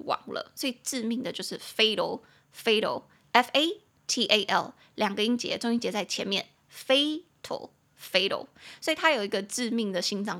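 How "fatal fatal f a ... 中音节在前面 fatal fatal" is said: fatal said slowly with stress on fa; stress on first syllable. Shown as a demonstration. (重音在前：fatal)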